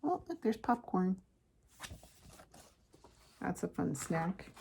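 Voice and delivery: ordinary delivery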